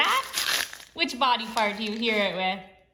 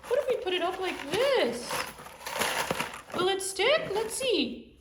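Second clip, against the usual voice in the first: high, bouncy little toy voice, full of glee; playful toy character; 14 semitones higher than usual. Acted. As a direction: play a cheerful little toy who just rode in. Host voices a motorcycle-riding toy character.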